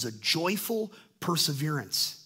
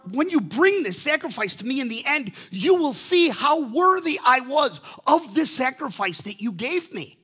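God's promise; 7 semitones higher, 6 dB louder than usual. Retold or imitated. God promising the believer at the end, loud and exalted. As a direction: promise the reward with conviction. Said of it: "he's saying".

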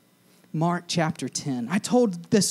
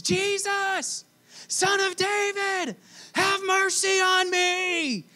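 high, slow pleading call; slower than usual. Acted. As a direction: cry out to him from the roadside. Blind man calling to Jesus.

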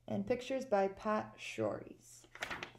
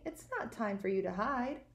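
plain reading voice

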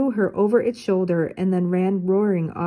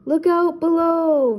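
high, loud, slow drawn-out holler; yelled warning; 9 semitones higher, 4 dB louder, slower than usual. Acted. Velma warns those below as she skis in, high and loud. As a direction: holler it while hurtling downhill. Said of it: "Velma's voice suddenly filled the air".

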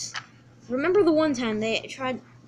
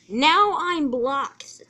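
slow, raised, loud grumble; exasperated complaint; 4 semitones higher, 6 dB louder, much slower than usual. Acted. Thomas finds his track blocked by Percy, exasperated. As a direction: stretch it out in exasperation.

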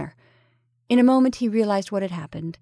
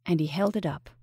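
ordinary delivery

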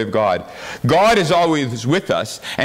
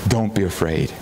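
gentle reassurance; 7 semitones lower, 4 dB quieter than usual. Acted. Jesus comforting John again.

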